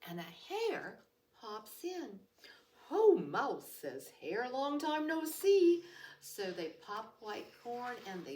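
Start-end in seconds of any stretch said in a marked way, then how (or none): 2.9-3.7 hearty hare. Acted. Hare greets Mouse. 4.5-5.8 hearty hare. Acted. Hare greets Mouse.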